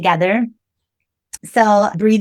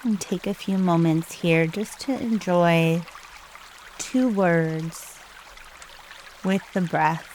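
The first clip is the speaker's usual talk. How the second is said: soft, hushed meditation guide with long pauses; calm, unhurried breathing instructions, spoken softly; guided meditation; 2 semitones lower, 8 dB quieter than usual. Real, not acted.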